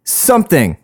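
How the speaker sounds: high-pitched mock YouTuber greeting; reaction YouTuber. Fed-up podcaster mimicking reaction videos, mock YouTuber voice. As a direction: parody an over-eager reaction video intro. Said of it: "without somebody going".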